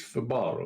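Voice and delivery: Fibaro as fuh-BAR-oh; stress on second syllable. Shown as a demonstration. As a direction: put the weight on BAR in Fibaro. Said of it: "Not the Italian version".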